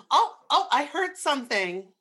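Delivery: sudden high, loud gasp of fright; startled. Pretend. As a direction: jump at a noise, half playing. Startled by a sound in the séance.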